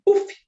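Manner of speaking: short, loud "puf" burst; mock pop sound. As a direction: spit out the popping noise. Imitates a pop in her head. (imitando um estalo alto "puf")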